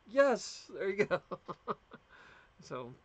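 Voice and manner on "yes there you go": high, loud happy cheer; delighted high-pitched welcome; excited cheer. Retold or imitated. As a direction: cheer it out like you just met a fellow Southerner. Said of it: "I'm like".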